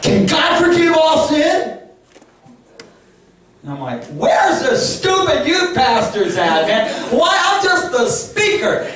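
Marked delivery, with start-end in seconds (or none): 0.0-1.8 high, loud, slow challenge; teen grabs the preacher and shouts the question; shouted question. Retold or imitated. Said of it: "and he says". 4.1-8.9 high, slow whiny plea; preacher's inner protest when cornered; comic panic. Retold or imitated.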